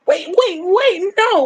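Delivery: high startled "wait"; sudden alarmed double take; surprised. Real, not acted. Startled by chat message.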